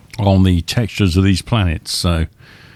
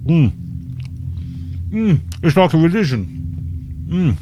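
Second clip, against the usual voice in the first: mouth full; 8 semitones higher than usual. Real, not acted. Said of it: "Excuse me whilst I'm eating".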